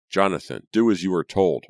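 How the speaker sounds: loud, commanding father; booming order. A narrator's reading. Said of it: "his voice booming through the house".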